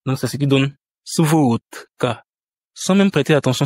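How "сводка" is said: Suvodka slowly, as "svOOt-ka"; D pronounced T. Shown as a demonstration. Teacher shows the result of assimilation, D pronounced T. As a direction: harden the d of Suvodka to t before ka. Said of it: "la consonne D pour le transformer en T".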